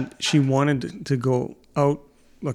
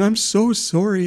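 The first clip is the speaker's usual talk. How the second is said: apologetic man; 7 semitones higher than usual. Retold or imitated. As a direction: plead sorry to the scared little girl. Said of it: "And he's going".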